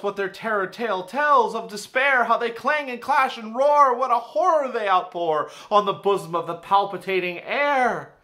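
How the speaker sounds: loud dramatic reading of terror; alarmed poem recital. Acted. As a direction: ring out the alarm in panic. Recites the alarm-bells stanza.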